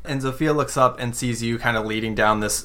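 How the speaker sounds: usual speaking voice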